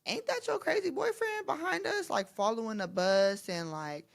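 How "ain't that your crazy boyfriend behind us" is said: high, mocking schoolgirl voice; teasing friends. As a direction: play the friend pointing it out, much higher. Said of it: "they're telling me".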